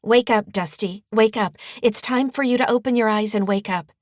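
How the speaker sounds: loud, high, insistent wake-up call. A narrator's reading. Rose at the hospital bed trying to wake her brother, loud and insistent. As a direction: shout it at a sleeping brother, pleading. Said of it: "In a loud voice".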